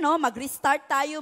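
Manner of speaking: ordinary talk, nothing marked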